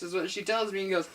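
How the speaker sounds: ordinary delivery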